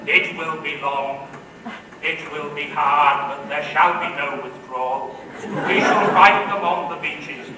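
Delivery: rally the nation like Churchill; defiant wartime Churchill speech; Winston Churchill impression. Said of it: "get my best Churchill voice on".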